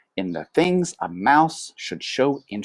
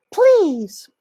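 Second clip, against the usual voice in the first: high, louder pleading mama mouse; mother; 8 dB louder than usual. A narrator's reading. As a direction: beg like a fussy mother. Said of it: "said his mother".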